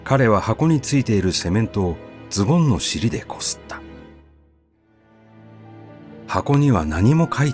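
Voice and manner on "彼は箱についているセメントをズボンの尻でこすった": ordinary delivery, nothing marked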